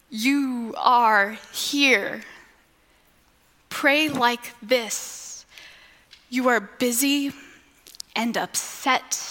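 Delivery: slow, measured prayer-guide delivery; reflective refrain. Acted. Preacher guiding listeners into prayer, slow reflective refrain. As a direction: lead them gently, leaving space between phrases.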